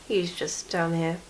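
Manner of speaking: ordinary talk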